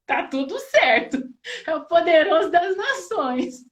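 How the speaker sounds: relieved words breaking into laughing sobs; laughing through tears. Real, not acted. Mother, just comforted by her son, laughs and cries in relief. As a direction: laugh and cry at once, loud with relief.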